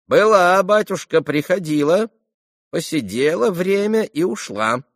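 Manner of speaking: put-on high, singsong old-woman voice; old servant woman. A narrator's reading. Old servant telling Mitya she left, high chatty voice. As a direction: answer chattily in a high crone's voice.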